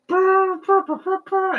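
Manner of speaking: high blubbering brr brr, very high and loud; mock sobbing. Streamer acting out crying at Superman, high loud blubbering. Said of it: "I'm gonna be like".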